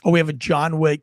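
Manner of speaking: ordinary talk, nothing marked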